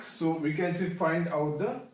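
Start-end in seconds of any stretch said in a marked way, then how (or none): none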